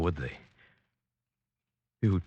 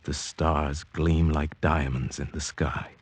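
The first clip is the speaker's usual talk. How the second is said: reflective narration. A narrator's reading. Narrated memory over music.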